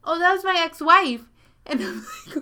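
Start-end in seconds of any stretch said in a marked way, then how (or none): 0.0-1.2 high, loud offhand reveal; casual reveal. Retold or imitated. Grandpa telling the narrator, loud offhand reveal. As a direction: drop the bombshell casually.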